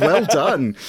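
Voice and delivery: condescending well done; patronizing praise. Real, not acted. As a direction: talk down like praising a pet. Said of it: "I love the way you said "well done".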